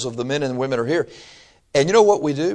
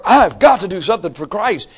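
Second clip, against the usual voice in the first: mock eager, higher-pitched outburst; zealous eagerness; 6 semitones higher, 3 dB louder than usual. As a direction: play a newcomer bursting with zeal. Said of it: "you've got this zeal".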